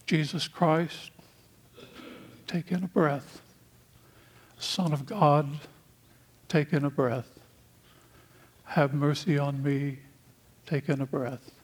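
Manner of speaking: a hushed meditation prayer shown to the congregation; breath prayer. Shown as a demonstration. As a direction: lead the congregation through a quiet breathing prayer. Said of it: "It works like this".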